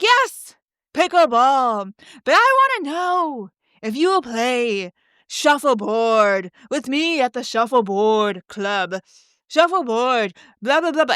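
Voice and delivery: slow, drawn-out send-up of a self-important questioner; mocking woman impersonation. Woman inviting the famous speaker to shuffleboard, mocking impersonation. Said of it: "That is a one hundred percent spot on impersonation".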